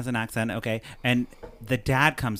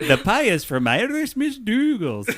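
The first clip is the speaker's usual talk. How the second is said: bad Irish accent; 8 dB louder than usual. Shown as a demonstration. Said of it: "here's my attempt to do the mom's accent".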